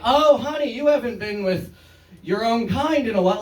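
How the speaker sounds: a touch of a sassy condescending woman; patronizing honey. Retold or imitated. Woman patronizing the comedian's girlfriend, mock sassy. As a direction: talk down sweetly, high on honey.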